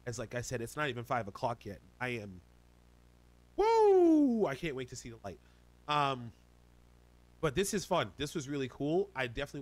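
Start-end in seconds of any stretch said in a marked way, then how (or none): 3.6-4.5 loud high woo; excited whoop. Real, not acted. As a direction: let out a big whoop. Excited cheer mid-outro.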